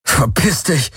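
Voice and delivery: angry outburst. A narrator's reading. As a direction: snap at your tormentor in panic. Captive lashing out at tormentor. (wütend und abwehrend ausgestoßen)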